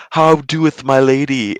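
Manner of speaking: slow mock olde English; renaissance fair olde speech. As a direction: ham up the Shakespeare talk. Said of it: "and they're like".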